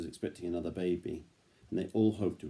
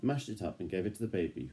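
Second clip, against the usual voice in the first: ordinary delivery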